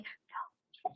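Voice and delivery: breathless whispered curse, no voice at all. Retold or imitated. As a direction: whisper it like you're shocked but can't be heard. Disbelief on hearing the show.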